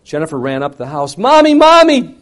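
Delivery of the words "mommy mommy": high, loud, drawn-out cry for mom; a child running crying for her mother; child calling out. Retold or imitated. Daughter running to the house calling mom, loud.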